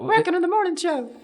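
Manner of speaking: high squeaky puppet voice. Pretend. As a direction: talk like a tiny puppet. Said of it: "The puppet's talking".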